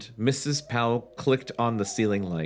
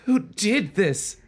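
high, slow frightened squeak; breathless scared kid voice; hammed-up terror; frightened girl; 10 semitones higher, 4 dB louder, slower than usual. Chris panicking, read aloud.

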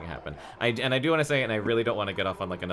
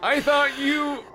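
squeaky big man voice; old man voice, very high; high-pitched voice; 6 dB louder than usual. Acted. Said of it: "He had a high-pitched voice".

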